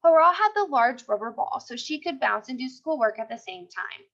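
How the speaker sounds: usual speaking voice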